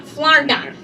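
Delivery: Florida as FLOR-da, falling at the end; two-syllable Florida. Shown as a demonstration. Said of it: "It's two syllables".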